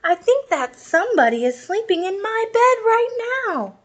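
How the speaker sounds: put-on high, hushed little kid; child voice. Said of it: "whispered the little person".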